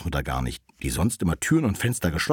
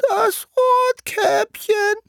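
extremely high, slow, loud falsetto; wolf's disguised voice; 7 dB louder, much slower than usual. Acted. Wolf posing as Rotkäppchen at the door, disguised falsetto. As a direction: drag it out in a squeaky fake girl voice. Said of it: "rief der Wolf mit verstellter Stimme".

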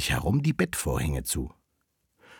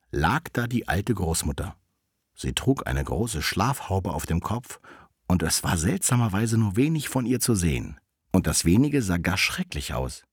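ordinary delivery, nothing marked

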